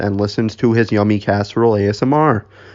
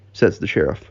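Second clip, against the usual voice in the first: ordinary delivery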